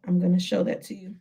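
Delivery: ordinary talk, nothing marked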